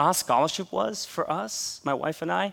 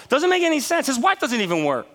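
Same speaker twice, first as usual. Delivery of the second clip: incredulous; 9 semitones higher, 5 dB louder than usual. Real, not acted. Incredulous about tuition money.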